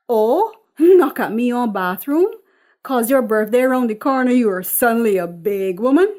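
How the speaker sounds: loud, scoffing mum voice; mocking mother. Mother mocking her daughter's wish for privacy, loud and scoffing. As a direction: scoff at her grown-up airs.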